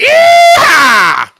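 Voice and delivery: high, hugely loud yee-haw yell; hammed-up holler. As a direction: whoop it out like a mock cowboy. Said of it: "you do that pretty damn good".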